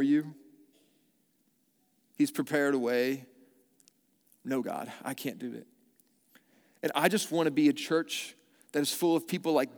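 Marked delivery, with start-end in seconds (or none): 4.4-5.7 soft, low whining refusal. Retold or imitated. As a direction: murmur it, unwilling. Reluctant believer refusing God.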